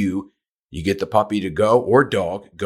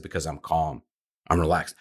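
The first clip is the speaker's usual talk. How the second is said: calm; 5 semitones lower, 7 dB quieter than usual. Retold or imitated. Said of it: "I said".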